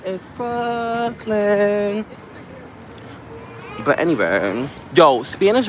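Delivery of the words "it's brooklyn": Brooklyn stretched slow and high; proud slow Brooklyn. Pretend. Correcting herself, then proudly announcing Brooklyn slowly. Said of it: "Wait, wait, wait".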